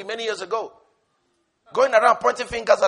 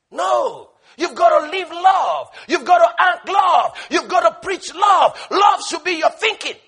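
loud, raised, punchy exhortation; forceful preaching; 6 semitones higher, 6 dB louder than usual. Real, not acted. Preacher urging the congregation to live love, forceful exhortation. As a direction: hammer each repeated phrase, high and loud.

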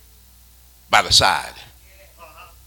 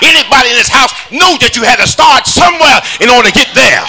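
shouted, sung preaching over the organ; fiery preaching; 3 semitones higher than usual. Acted. Preacher whooping to the congregation, sung shout. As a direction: whoop it to the church, rising. Preacher at full force, music.

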